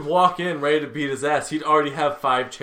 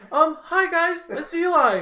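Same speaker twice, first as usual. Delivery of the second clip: very high, loud squeaky greeting; hammed-up high-pitched video intro; Eli; 6 dB louder, slower than usual. Shown as a demonstration. Castmate joining in as Eli, squeaky YouTuber greeting. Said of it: "is this what we're doing now".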